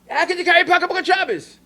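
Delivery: scolding grandmother. Retold or imitated.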